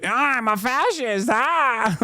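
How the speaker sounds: high-pitched, hammed-up glee; gleeful mock fascist. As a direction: crow it high, mocking his delight. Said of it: "how happy he is to be like".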